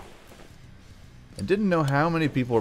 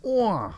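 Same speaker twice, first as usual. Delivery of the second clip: mock rock wail; 12 semitones higher than usual. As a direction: belt a hammed-up rock scream. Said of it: "Sing it, [name]".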